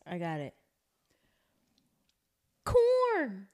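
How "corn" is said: high, loud excited cry; excited squeal. Real, not acted. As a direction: blurt it out thrilled. Excited answer shout.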